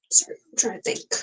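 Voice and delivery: whispered aside while thinking. Real, not acted. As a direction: drop to a breathy whisper, searching for words. Pausing to think on stream.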